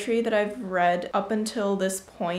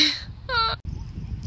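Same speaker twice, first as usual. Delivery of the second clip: high laugh; 13 semitones higher than usual. Real, not acted. Laughs at own pun.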